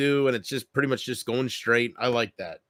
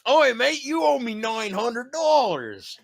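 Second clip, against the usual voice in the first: put-on high, slow Aussie kid voice; Australian kid; 10 semitones higher, slower than usual. Retelling Bart's call to the Australian kid, Aussie accent. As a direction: drawl it like a cheeky Aussie kid. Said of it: "that Australian kid".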